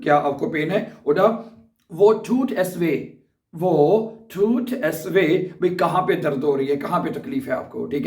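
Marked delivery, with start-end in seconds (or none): 1.9-5.5 "वो टूट एस वे" stretched slowly, syllable by syllable; slow dictation. Shown as a demonstration. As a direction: spell it out slowly for learners. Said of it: "ये दो जुमले बोले जा सकते हैं".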